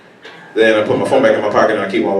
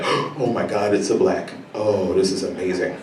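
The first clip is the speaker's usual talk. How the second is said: hushed mock excitement; 5 semitones lower, 10 dB quieter than usual.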